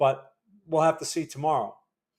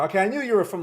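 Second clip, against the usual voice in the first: normal talking voice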